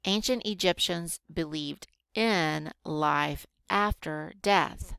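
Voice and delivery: unhurried teacher reading with spaced-out words; slow reading. Shown as a demonstration. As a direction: read slowly, word by word. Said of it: "Did you see how slow I read".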